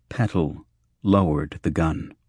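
usual speaking voice

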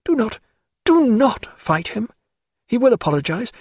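high, halting woman's plea; pleading. A narrator's reading. Diana begging Stephen not to fight, pleading. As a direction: implore him, leaning on NOT.

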